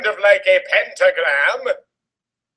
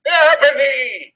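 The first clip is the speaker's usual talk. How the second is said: drawn-out hammed-up call of the name; mock yell; 9 semitones higher, 4 dB louder, slower than usual. Reading Voldemort calling Ebony, slow mock yell. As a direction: call her name slowly, like a dark lord. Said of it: "he yelled".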